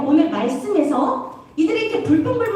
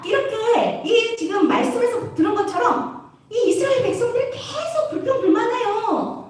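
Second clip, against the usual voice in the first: ordinary talk, nothing marked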